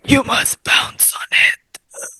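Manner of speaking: slow, whispered vampire with heavy breaths; drawn-out, breathy Nosferatu imitation; Count Orlok voice. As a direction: whisper each word like an undead count. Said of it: "And then they go".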